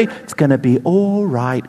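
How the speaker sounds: soothing British mum, low and slow; British accent. Retold or imitated. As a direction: comfort a child in a British accent. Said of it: "In a British accent".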